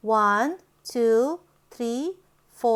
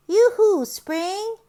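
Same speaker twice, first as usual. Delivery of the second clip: high, louder yoo-hoo; calling out; 7 semitones higher, 3 dB louder than usual. Acted. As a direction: call out joyfully. Calling out to spring.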